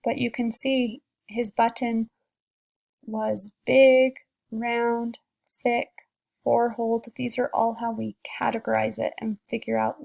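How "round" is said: plain reading voice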